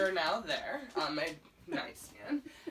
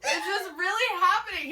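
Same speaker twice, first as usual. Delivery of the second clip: amazed; 9 semitones higher, 9 dB louder than usual. Retold or imitated. Retelling amazement, laughing-crying.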